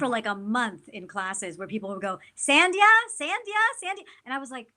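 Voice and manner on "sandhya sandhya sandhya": Sandhya called out high and mangled, again and again; mangled name calls. Retold or imitated. Classmates calling out her name, mangling Sandhya in high calls. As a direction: mimic classmates mispronouncing Sandhya in a high sing-song. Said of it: "they butchered it".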